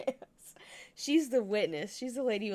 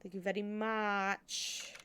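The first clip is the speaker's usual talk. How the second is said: slow formal thanks; much slower than usual. Pretend.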